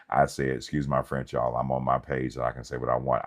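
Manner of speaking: usual speaking voice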